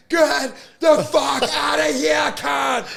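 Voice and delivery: high, loud, drawn-out acted roar; voice-blowing yell. Comic chasing pieman off stage, full retold yell. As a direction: yell as if wrecking your voice. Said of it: "it was me yelling. I blew my voice out".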